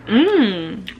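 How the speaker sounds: savoring hum. Real, not acted. Savoring the taste.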